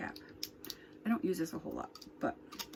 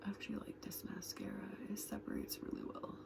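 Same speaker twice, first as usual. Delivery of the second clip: soft breathy whisper, close to the mic; 8 dB quieter, faster than usual. Real, not acted. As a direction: whisper it like an ASMR tutorial. Whispered makeup chat.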